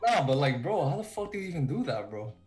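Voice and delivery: ordinary talk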